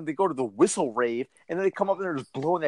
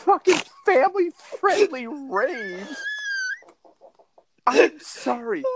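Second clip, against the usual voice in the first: high, outraged disbelief; can't believe what he just heard; incredulous; 13 semitones higher than usual. Real, not acted. Incredulous outburst at the news.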